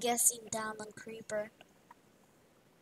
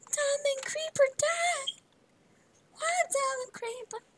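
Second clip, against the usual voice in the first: high, loud dramatic wail; hammed-up, high-pitched lament; mock-distraught cry; 13 semitones higher, 6 dB louder than usual. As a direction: cry out in over-the-top grief. Game companion dies, mock grief.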